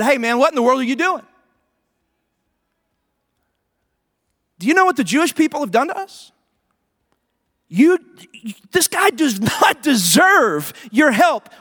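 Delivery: a hint of a scolding buddy; mock buddy's protest. Buddy scolding the Samaritan for helping, mildly mocking. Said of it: "they would have said".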